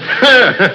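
short high amused laugh; scoffing laugh. Acted. Father laughing off the Lucifer painter legend, scoffing. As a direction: laugh it off as nonsense.

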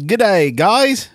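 a quick Australian g'day; Australian accent. Pretend. Reading a fan's email, in an Australian accent. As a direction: greet them in a broad Australian accent. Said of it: "that's it for my Australian accent".

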